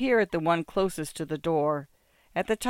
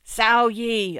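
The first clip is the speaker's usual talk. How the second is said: higher, louder call from another room; 6 semitones higher, 6 dB louder than usual. A narrator's reading. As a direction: call for someone in the next room. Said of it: "a hoarse voice calls from another room".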